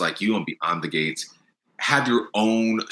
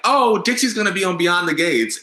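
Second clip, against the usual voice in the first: excited fans; 10 semitones higher, 3 dB louder than usual. Retold or imitated. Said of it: "so many people were like".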